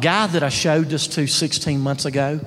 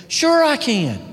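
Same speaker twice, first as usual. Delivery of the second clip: eager yes; 11 semitones higher, 4 dB louder, slower than usual. Retold or imitated. Tracy eagerly agreeing, quoted.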